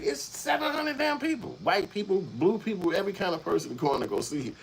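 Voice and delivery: can you believe this crowd; exasperated disbelief. Retold or imitated. Exasperated about crowd size.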